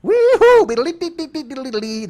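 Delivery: high-pitched gibberish yokel holler; hammed-up old-timer whoop. Comic mocking how twenties Americans talked, high gibberish whoop. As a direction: whoop and babble like a cartoon prospector. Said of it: "That's how we all talked".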